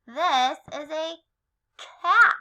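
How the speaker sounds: usual speaking voice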